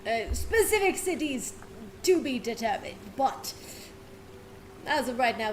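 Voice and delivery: ordinary talk, nothing marked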